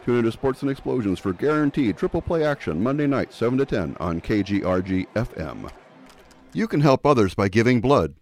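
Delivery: upbeat radio promo voice; ad read. Acted. Station announcer reading a blood drive ad, upbeat. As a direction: sell the spot to listeners.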